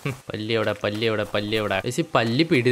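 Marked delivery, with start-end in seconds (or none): none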